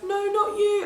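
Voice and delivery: loud, high giddy protest. Retold or imitated. Patient telling the midwife not you, loud and giddy. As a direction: squeal it like a woozy patient. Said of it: "I was so high on gas and air".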